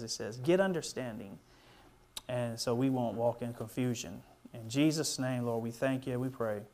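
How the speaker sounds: soft, low closing prayer. Acted. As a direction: pray quietly over the congregation.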